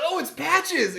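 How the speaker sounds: excited. Retold or imitated. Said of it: "you're like".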